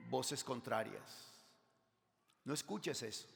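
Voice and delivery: ordinary talk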